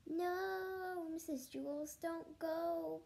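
high, drawn-out plea; begging. Acted. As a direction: plead with the teacher to stay. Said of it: "begged Jason".

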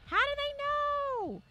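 thin, drawn-out baffled squeal; high-pitched wail. Real, not acted. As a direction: stretch it out slowly, very high. Baffled at being detected while quiet.

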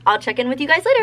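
ordinary talk, nothing marked

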